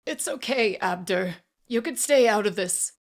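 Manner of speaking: high, urgent reassurance; strained reassurance. A narrator's reading. Auctioneer telling boy to stay out, strained. Frightened man calms the boy.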